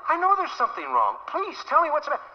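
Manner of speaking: mock husband pleading with his upset wife. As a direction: beg her to tell you.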